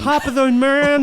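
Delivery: loud, high mock Irish; Irish accent. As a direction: ham up a leprechaun greeting. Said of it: "criticizing your Irish accent".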